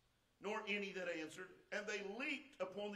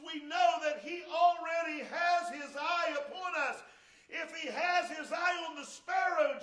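louder, higher preacher's cry building to a shout; full-force preaching; 5 semitones higher, 7 dB louder than usual. Acted. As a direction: preach it hard to the whole congregation.

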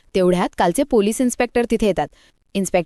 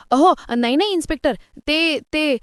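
panicked; 7 semitones higher than usual. A narrator's reading. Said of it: "Rakesh घाबरतो".

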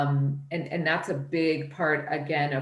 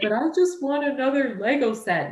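put-on whiny little boy; 8 semitones higher, 3 dB louder than usual. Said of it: "When he's like".

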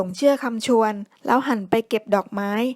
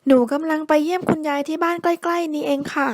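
put-on higher, friendly little girl's voice; sweet child answering a stranger; 6 semitones higher, 3 dB louder than usual. A narrator's reading. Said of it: "ตอบอย่างเป็นมิตร". (เสียงเด็กหญิงตัวน้อยตอบอย่างเป็นมิตร)

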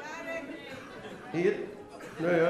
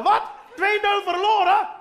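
mock hooligan; 6 semitones higher than usual. Hooligan after a lost match, mock outrage. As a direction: play a hooligan shocked at the score.

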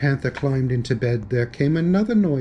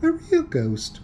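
surprised. Acted. Continued surprise.

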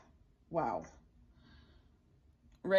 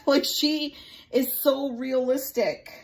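laughing through it, louder and slower; 7 semitones higher, 8 dB louder, slower than usual. Real, not acted. Vlogger laughing about her lifelike doll, delighted laugh. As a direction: giggle with delight while you say it.

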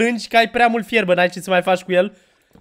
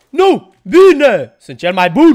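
loud, high victory yell; triumphant shout; 3 semitones higher, 9 dB louder, much slower than usual. Real, not acted. Gamer shouting after beating the mob, triumphant yell. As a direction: crow over the win at full voice.

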